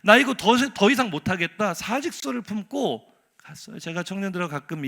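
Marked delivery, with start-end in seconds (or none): 0.0-1.7 loud, exasperated declaration; fed-up outburst. Acted. Prophet voicing his wish to resign, fed up. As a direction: blurt it out loudly, at the end of patience.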